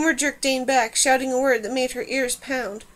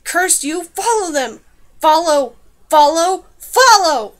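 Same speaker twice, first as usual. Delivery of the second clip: raised, furious shouting that builds louder; shrieked command; 4 semitones higher, 11 dB louder, slower than usual. A narrator's reading. Man cursing and ordering pursuit, building shriek. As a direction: shriek the order, each repeat more forceful. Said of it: "Dane heard a man shriek".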